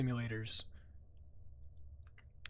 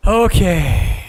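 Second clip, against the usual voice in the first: loud okay; 3 semitones higher, 9 dB louder than usual. Real, not acted. Loud reaction.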